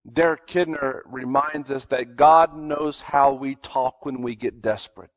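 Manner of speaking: normal talking voice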